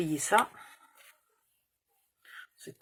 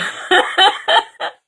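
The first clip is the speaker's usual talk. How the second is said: high breathy laugh; 13 semitones higher, 11 dB louder than usual. Real, not acted. Laughing at her own story.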